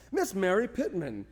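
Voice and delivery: ordinary talk, nothing marked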